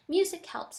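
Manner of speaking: the father's words, said loud and high; father's line. Acted. Reading aloud to students, voicing the father loudly. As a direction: lift it out loud, like the father's saying.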